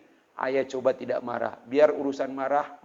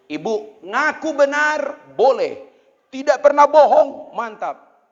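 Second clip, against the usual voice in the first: loud, high, emphatic preaching; preaching at full force; 11 semitones higher, 12 dB louder, slower than usual. Acted. Preacher loudly lecturing the mothers. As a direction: hammer each point home.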